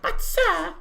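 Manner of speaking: put-on very high, loud little-boy pleading. GM re-enacting the boy pleading with players, little boy voice. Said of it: "could not handle the little boy voice".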